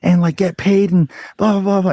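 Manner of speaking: screaming. Retold or imitated. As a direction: replay a teenage tantrum at the shelter staff. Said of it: "And I'm screaming at everybody".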